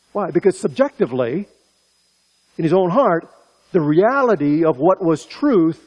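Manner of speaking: fiery preaching. Acted. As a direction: preach it out to the congregation at full force. Preaching at full force.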